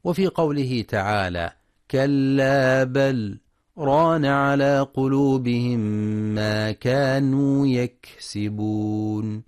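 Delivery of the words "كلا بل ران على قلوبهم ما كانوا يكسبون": slow, drawn-out tajweed chant; Quran recitation. Acted. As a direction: recite with measured, melodic stretching. (تلاوة مجودة متأنية)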